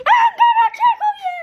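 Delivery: very high, loud chipmunk yell; chipmunk shout. Acted. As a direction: shriek it like a cartoon chipmunk.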